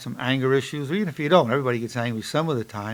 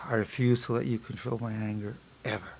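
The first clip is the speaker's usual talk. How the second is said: low, hushed affirmation on the out-breath; relaxed self-talk; 4 semitones lower, 8 dB quieter than usual. Shown as a demonstration. Psychologist repeating mantra after deep breath, calm and low. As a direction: say it softly and evenly, eyes closed. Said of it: "Deep, get deep breath".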